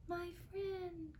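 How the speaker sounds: high and soft tiny voice; tiny Who voice. A narrator's reading. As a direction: call up small and faint from the speck. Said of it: "came the voice".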